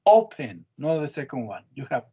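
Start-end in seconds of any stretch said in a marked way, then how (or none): 0.1-0.6 "Open" with a loud, high first syllable; stress on first syllable. Shown as a demonstration. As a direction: punch the O in "Open", let the end fall. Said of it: "first syllable stress".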